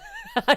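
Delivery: squeaky high laugh; a sudden shrill cackle; high burst of laughter. Real, not acted. Laughs after taunt.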